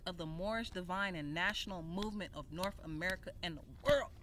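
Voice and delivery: ordinary talk, nothing marked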